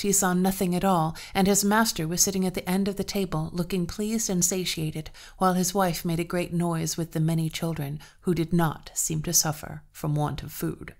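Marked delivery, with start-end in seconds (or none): none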